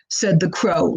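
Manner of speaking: plain reading voice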